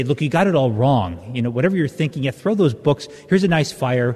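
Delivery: usual speaking voice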